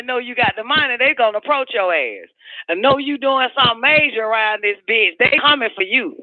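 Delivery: ordinary talk, nothing marked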